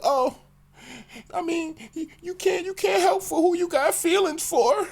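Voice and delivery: high breathy woman's voice; girlfriend voice. Retold or imitated. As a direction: voice her high and excuse-making.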